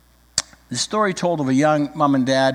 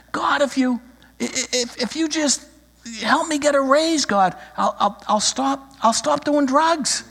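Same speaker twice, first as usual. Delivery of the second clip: higher, halting, stuttering plea; stammering mock prayer; 9 semitones higher than usual. Believer stammers a deal to God for a raise, higher and halting. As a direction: stumble over a desperate promise to God.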